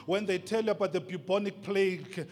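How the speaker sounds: loud, insistent sermon lines building to a peak; forceful preaching. Acted. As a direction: drive the warning home.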